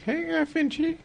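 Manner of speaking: high, slow creepy caller voice. Retold or imitated. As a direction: drawl it out like a creepy stranger. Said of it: "It's gonna be like ... creepy voicemail".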